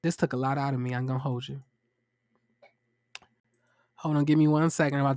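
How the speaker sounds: low, soft and weary; real tiredness. Real, not acted. Host winding down after a long review, quietly worn out. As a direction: let the energy drop away after a sigh. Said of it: "I'm a little tired".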